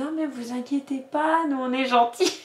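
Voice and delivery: put-on high-pitched, sweet little child's voice; cute little kid. Little pupil reassuring a nervous parent volunteer, cute high kid voice. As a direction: imitate a small kid piping up reassuringly. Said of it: "un petit qui a entendu notre conversation, qui était tout mignon".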